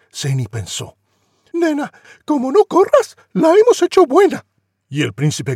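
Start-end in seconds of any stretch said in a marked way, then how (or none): 1.5-4.4 put-on high, loud panicked girl voice; a much higher frantic young girl; Cinderella. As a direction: squeal it out in a rush to flee.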